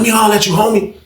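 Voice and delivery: lightly voiced insistent inmate; number two dude. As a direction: press him to talk now. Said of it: "So he like".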